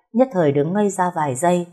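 usual speaking voice

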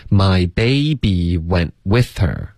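slow, stretched-out teacher's model; slow linking demo. Shown as a demonstration. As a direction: draw out each word, linking with her. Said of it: "然后连读". (慢速示范连读，每个词拉长)

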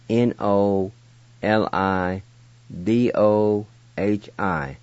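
ordinary delivery

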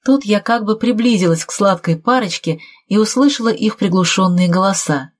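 ordinary delivery

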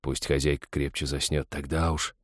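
hushed, low thinking to himself; very soft inner monologue; private thought. A narrator's reading. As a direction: think it softly, under your breath. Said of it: "подумал он".

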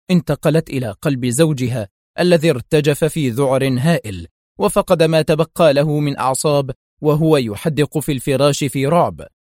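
ordinary delivery

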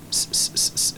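unvoiced "s, s, s" with no vowel; hissed s. Shown as a demonstration. Teacher demonstrating a voiceless s for students. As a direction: hiss a long bare s. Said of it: "esa es la S".

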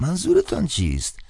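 put-on startled old priest on the phone. A narrator's reading. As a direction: answer like a wary old cleric.

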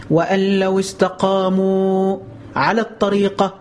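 slow melodic tajweed chant; sung Quranic recitation. Acted. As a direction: stretch the verse out, intoning.

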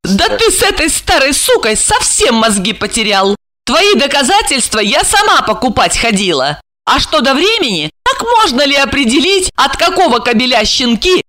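high-pitched spiteful woman; high, jeering scorn; malicious gloating. A narrator's reading. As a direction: taunt them with high, vicious glee. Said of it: "прозвучал злорадный смех".

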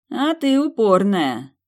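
high, slow, impressed drawl; approving amusement. A narrator's reading. Kioshi acknowledging heroine's stubbornness, amused approval. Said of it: "одобрительно усмехнулся Киоши".

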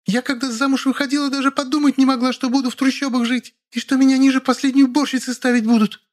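high-pitched aggrieved wife; tearful tirade. A narrator's reading. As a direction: complain hysterically in falsetto. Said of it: "Она громко разрыдалась". (истеричная жалоба высоким голосом)

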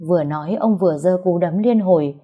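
ordinary delivery, nothing marked

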